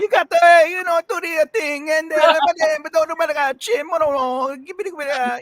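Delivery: high-pitched mock Italian jabber; Italian accent. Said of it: "the Italian guy who's on my recorder".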